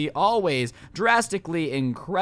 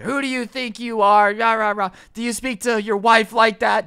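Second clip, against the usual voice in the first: raised, high mock tirade; going off; 4 semitones higher, 5 dB louder than usual. Customer rounds on the man who heckled her, high and irate. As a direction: imitate an angry customer laying into someone. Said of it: "Just going off on everyone".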